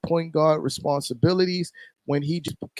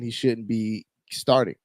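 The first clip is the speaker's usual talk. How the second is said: ordinary talk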